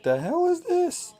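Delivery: slow, high disbelieving squeak; baffled high squeak. Retold or imitated. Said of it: "and I was like".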